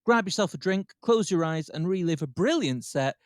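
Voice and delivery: big lead-in to a recorded live set; show announcer intro. Acted. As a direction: rise steadily into introducing the headline act.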